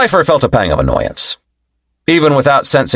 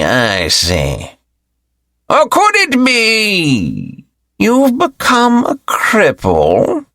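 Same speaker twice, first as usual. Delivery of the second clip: high, slow mocking elder voice; arrogant old elder taunting; 11 semitones higher, much slower than usual. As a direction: taunt him with slow contempt.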